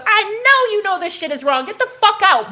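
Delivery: high, forceful retold telling-off; acted exasperated outburst; angry eviction. Acted throwing both men out.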